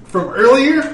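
loud, high, boastful call; dopey cartoon bellow. Hero shows off biceps to orc.

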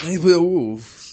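Sniper Wolf clipped to "neip wuv"; Japanese pronunciation. Shown as a demonstration. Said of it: "That's how they say it in Japanese".

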